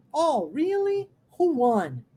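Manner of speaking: "Oh, really?" swinging up high, "Who won?" falling; sing-song rise on "really", fall on "won"; marked intonation. Shown as a demonstration. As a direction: stretch "really" upward, then let "won" drop. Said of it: "olha como é que tem entonação".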